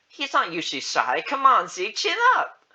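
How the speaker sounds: high, loud cheering-on that builds; encouraging. Acted. Beth urging Zeke not to be shy, encouraging. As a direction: urge the child on, climbing in pitch. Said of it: "Beth encouraged me".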